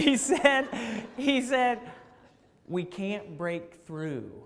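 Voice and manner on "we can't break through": grim bad news. Plumber delivering bad news.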